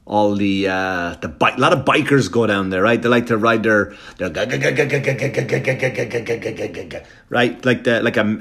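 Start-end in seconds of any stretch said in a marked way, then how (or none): 4.2-7.0 rapid throaty engine chug; imitated motorbike engine. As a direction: imitate a Harley rumbling along. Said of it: "I just hurt my voice there".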